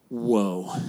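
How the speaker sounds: low, soft, hushed whoa with a small laugh; awed whoa. Retold or imitated. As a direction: breathe it out in quiet awe. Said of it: "it's in a Christopher Walken voice, but I won't do that".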